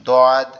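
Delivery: দদ said loud and clear; letter demo. Shown as a demonstration. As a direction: call out দদ firmly as the model. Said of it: "আমরা বুঝবো".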